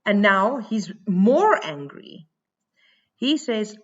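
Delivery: ordinary delivery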